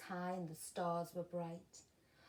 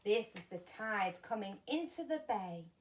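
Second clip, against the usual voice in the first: ordinary delivery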